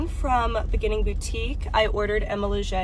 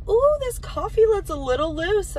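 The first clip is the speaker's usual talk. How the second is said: cashier; 10 semitones higher than usual. Retold or imitated. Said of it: "she's like".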